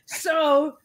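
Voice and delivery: slow, high, put-on cartoon cat voice; Stimpy. Imitating Stimpy while scrubbing floors, slow and high. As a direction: ham up a giddy cartoon cat. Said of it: "I was like, Stimpy".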